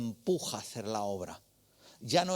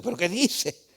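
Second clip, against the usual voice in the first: loud, high startled cry of disbelief; shocked outburst; 7 semitones higher, 8 dB louder than usual. Retold or imitated. Retelling his shock as his friend offers to fight, loud startled disbelief. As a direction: blurt it out, can't believe what he's hearing.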